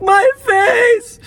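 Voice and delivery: high, crying shout; hammed-up cry; mock wail. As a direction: wail about your face. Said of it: "I was like".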